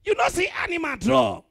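high, loud indignant shouting; outraged rant. Acted. Comedian berates a man for his Jesus tattoo, shouting in outrage. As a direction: scold him at full volume, scandalised.

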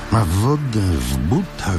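ordinary delivery, nothing marked

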